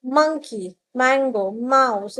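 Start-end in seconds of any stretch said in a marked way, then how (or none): none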